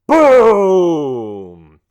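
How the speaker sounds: very loud boom, high start, long slide down; booming blast. A narrator's reading. Narrator voices a second explosion, booming. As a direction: burst out and let it fall.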